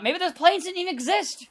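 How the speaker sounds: high-pitched, joking scepticism; mock conspiracy denial. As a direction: float an absurd denial as a joke. Joking conspiracy denial, called a joke.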